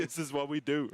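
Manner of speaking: amused, laughing echo. Real, not acted. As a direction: repeat it through a laugh. Repeats quote while laughing.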